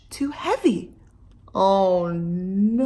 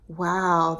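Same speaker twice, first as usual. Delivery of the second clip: plain reading voice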